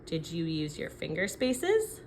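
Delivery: ordinary talk, nothing marked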